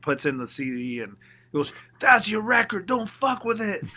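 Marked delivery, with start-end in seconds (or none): 2.0-3.8 high, loud boss imitation; mock Donnie. Label president orders the artist not to change it, loud high mock voice. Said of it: "he's pretending he's Donnie".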